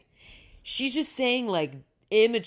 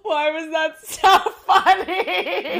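laughing fit; 8 semitones higher, 9 dB louder, much slower than usual. Real, not acted. Cracking up at the episode.

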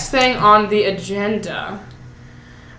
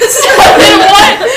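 slow, high, louder laughing question; laughing disbelief; 11 semitones higher, 7 dB louder, much slower than usual. Retold or imitated. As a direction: ask it drawn out while cracking up. Retelling confusing pool exchange.

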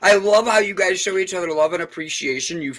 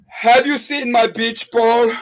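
performed bit; 4 semitones higher than usual. Acted. Host performing his joke bit for the guests. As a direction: deliver the joke as a little skit. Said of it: "This is how it goes".

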